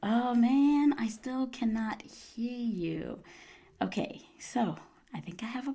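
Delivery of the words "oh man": ordinary delivery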